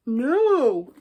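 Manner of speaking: loud, high, startled "No!"; sudden "no". Real, not acted. A booktuber realizing her notes are missing, a startled shout. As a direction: cry out as you remember something.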